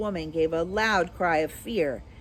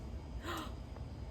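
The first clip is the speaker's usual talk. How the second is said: gasp; 9 semitones higher, 16 dB quieter than usual. Real, not acted. Reader reacting to story end.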